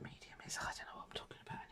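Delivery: breathy library whisper. Real, not acted. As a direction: hush it to a whisper. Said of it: "Stop whispering".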